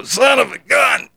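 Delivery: high shout, slowly, of pain. Retold or imitated. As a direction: yell it out flat on your back in pain. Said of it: "I say, go".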